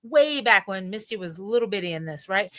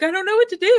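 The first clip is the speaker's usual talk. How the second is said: imitating a crying client; 9 semitones higher, 7 dB louder, faster than usual. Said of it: "They're crying".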